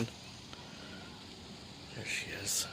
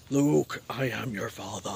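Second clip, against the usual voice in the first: Darth Vader; 8 semitones lower, 3 dB quieter, slower than usual. Retold or imitated. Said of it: "Sorry for my Darth Vader breathing".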